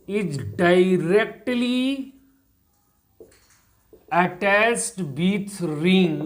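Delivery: very slow, word by word with long gaps; dictating while writing. Shown as a demonstration. Teacher dictating the rule to students, very slow. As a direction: spell it out slowly as you write it on the board. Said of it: "तो यहां लिख दे रहे हैं".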